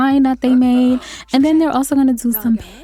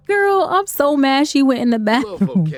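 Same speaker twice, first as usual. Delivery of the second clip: talking through a laugh, higher; 5 semitones higher than usual. Real, not acted. Player cracking up at her Sim's bathroom food prep, laughing. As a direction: crack up while saying it.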